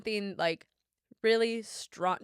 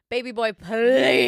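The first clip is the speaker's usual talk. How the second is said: swooning plea; 3 semitones higher, 11 dB louder, slower than usual. Retold or imitated. Swooning reply to Austin Butler.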